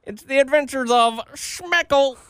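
high put-on cartoon announcer; co-host introduces his comic character. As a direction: announce it like a silly show title.

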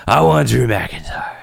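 gruff Batman impression. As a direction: growl it like Batman. Doing a Batman voice for Ambrose.

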